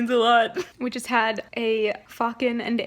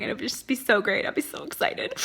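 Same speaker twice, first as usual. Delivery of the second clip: usual speaking voice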